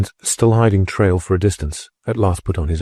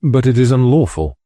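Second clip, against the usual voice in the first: raised, indignant protest; a louder, higher outraged objection; 6 semitones higher, 4 dB louder than usual. A narrator's reading. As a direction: object loudly. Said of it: "was the protest".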